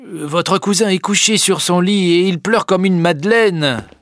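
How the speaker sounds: high, loud servant's exclamation; servant Nanon. A narrator's reading. Nanon tells Eugénie her cousin is weeping, high loud exclamation. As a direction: call it out high and emphatic.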